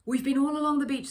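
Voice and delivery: put-on worried boy reporting the search, breathless and urgent; urgent call. A narrator's reading. Boy tells the lifeguard they searched everywhere, loud and urgent.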